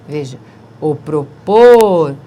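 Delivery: "propor" slow and loud, final syllable stressed. Shown as a demonstration. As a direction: lean on "propor". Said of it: "nós temos aqui uma oxítona". (ênfase em "propor")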